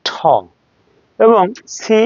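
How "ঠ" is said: ঠ with a strong puff of breath; aspirated T. Shown as a demonstration. Teacher giving the sound of T, aspirated ঠ. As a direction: sound T like Bengali ঠ. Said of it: "টির উচ্চারণ টি না হয়ে হবে".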